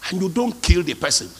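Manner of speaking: ordinary delivery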